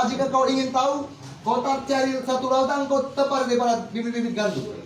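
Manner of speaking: usual speaking voice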